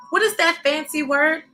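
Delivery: plain reading voice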